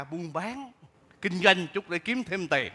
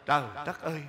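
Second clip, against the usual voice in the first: usual speaking voice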